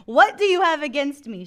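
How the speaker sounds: high, loud, exasperated protest; indignant accusing mother. Acted. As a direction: plead your case loudly, half laughing at it. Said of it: "she's over there going".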